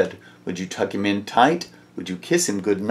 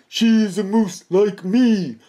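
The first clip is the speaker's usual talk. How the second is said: higher, insistent yell; 9 semitones higher than usual. Acted. Morris yelling at the deer that she is a moose. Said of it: "Morris yelled".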